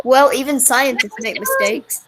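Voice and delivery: ordinary talk, nothing marked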